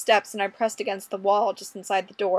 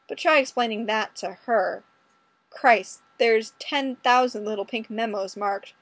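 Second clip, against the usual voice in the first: ordinary delivery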